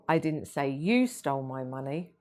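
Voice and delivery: "you" lifted above the other words; stress on YOU. Shown as a demonstration. Trainer shows how tone shifts meaning, stress on "you". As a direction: point it at "you". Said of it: "spoken in several different ways".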